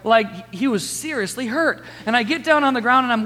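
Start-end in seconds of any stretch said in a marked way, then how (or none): none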